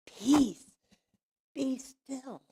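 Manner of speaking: high-pitched call softening at the end; calming command. Retold or imitated. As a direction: repeat it to settle the storm, easing off. Command repeated, settling down.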